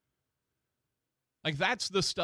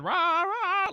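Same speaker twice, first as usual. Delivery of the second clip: high, loud mock chant of the speakers; imitating a chant; 6 dB louder than usual. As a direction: belt it out like the Lion King opening. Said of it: "like it was playing The Lion King".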